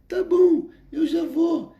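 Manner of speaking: retold coworker in a high girlish falsetto; imitating thin high voice. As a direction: mimic a boy with a very high thin voice. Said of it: "ele falava bem fino".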